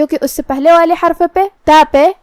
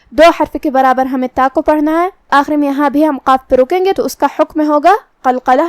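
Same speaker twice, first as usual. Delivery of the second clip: ordinary talk, nothing marked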